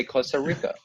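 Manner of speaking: imitating a gringo saying Costa Rica; American accent Spanish. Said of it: "they say it with a gringo accent".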